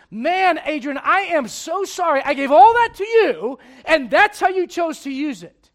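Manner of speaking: loud, pained rebuke from the throne; God's loud regret. Acted. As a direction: let the disappointment burst out loud. God regrets what Adrian did.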